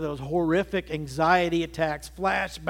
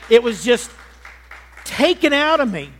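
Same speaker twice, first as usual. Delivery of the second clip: louder, higher testimony cry; preaching burst; 4 semitones higher, 7 dB louder than usual. Real, not acted. Preacher testifying about his deliverance, loud and raised. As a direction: lift it up and out with force.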